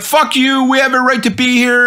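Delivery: defiant protesters. Retold or imitated. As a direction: sneer it back like an indignant heckler. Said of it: "And then they're like".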